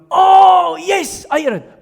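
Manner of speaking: high, loud, drawn-out oh; feigned recognition. Acted. Companion claims to hear the birder's bird, feigned recognition. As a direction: overdo the sudden realisation.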